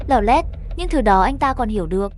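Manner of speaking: plain reading voice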